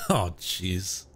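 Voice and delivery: ordinary talk, nothing marked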